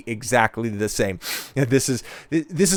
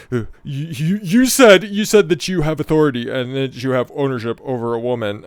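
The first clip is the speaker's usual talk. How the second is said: raised mock-accusing voice, easing down; mocking imitation; 2 semitones higher than usual. Streamer parroting an interviewer's accusation, mocking. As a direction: parrot the interviewer, stammering at the start.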